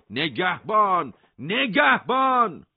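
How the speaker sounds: long, drawn-out call for the guard; shout. A narrator's reading. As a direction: yell it slowly across the cellblock. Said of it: "داد زد". (فریاد کشیدار)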